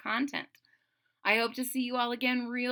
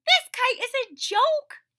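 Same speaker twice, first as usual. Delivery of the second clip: high, louder, fed-up complaint; Toad's voice; 12 semitones higher, 8 dB louder than usual. Acted. Toad complaining to Frog, high fed-up whine. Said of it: "he said".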